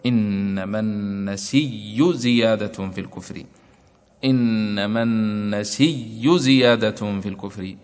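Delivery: slow recitation, النسي with a doubled y and no hamza; النسي said an-nasiyy, slowly recited; Warsh's reading. Shown as a demonstration. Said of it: "فصارت القراءة عنده".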